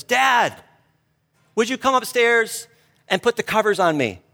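put-on high, small child's voice calling down; a five-year-old calling for dad from upstairs; little boy voice. Son calling dad from bed upstairs, high little child voice. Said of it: "the little voice from upstairs says".

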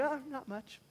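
evasive reply. Acted. Preacher acting a believer dodging a friend's question, evasive.

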